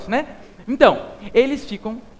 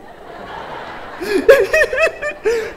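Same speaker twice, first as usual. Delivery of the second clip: high giggling fake sob; high mock laugh; 14 semitones higher, 6 dB louder than usual. Joking Eva laughs it off, high giggle. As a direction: burst into a silly high giggle. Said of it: "Brincadeira, não foi desse jeito".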